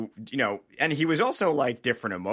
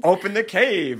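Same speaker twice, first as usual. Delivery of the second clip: quoting the doctor; 7 semitones higher, 7 dB louder than usual. Retold or imitated. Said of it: "and he goes in his mouth".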